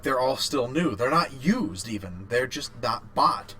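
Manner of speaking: ordinary delivery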